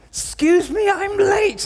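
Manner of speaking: high, loud, panicked royal cry; flustered running Queen. Acted. Imagined Queen running late at Trooping the Colour, flustered shouting. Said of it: "Can you imagine the Queen at the Trooping of the Colour running?".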